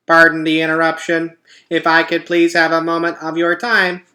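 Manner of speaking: chirpy and eager to get everyone's attention; perkiest voice. A narrator's reading. Roz opens the dawn truce with an announcement, perky. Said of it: "Roz began speaking in her perkiest voice".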